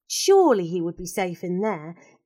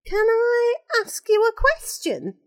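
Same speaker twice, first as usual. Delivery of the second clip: put-on a boy's voice, higher, hesitant; nervous lad asking, pitched up; unsure boy. Ricky nervously greets the crying woman, unsure boy.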